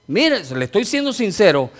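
usual speaking voice

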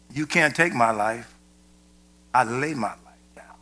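soft, low, calm defiance ending in a whisper; quietly stare down the centurion; hushed defiant martyr. Retold or imitated. Martyr answering the centurion, hushed and unshaken. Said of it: "stares him down and says".

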